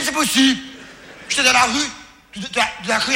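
ordinary delivery

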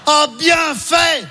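high, gleeful shout of bien fait; gleeful gloat. Acted. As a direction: crow with delight at their misfortune. Joie moqueuse. (cri de joie moqueuse)

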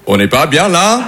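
lazy French contentment. Retold or imitated. Comedian imitating a relaxed French drinker, lazy and content. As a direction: savour it like a Frenchman with a glass. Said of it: "on va te poser la question".

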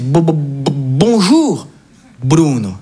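put-on halting, stammering old man; lightly voiced emotional grandfather; moved grandfather stammering. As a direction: stumble over the greeting, a touch choked up. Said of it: "il est tout ému".

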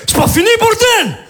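high wild yell; crazy shout. Acted. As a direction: scream at the neighbour like a madman. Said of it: "je fais". (hurlement de fou)

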